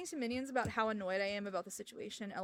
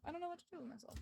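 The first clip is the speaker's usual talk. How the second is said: softer despairing repeat; 5 semitones higher, 14 dB quieter, much faster than usual. Real, not acted.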